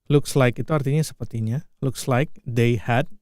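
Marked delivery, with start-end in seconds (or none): 1.8-3.1 slowly, word by word; slow repeat. Shown as a demonstration. Teacher breaking down the phrase, slowly. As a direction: lay out each word for learners. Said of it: "teacher slows the phrase".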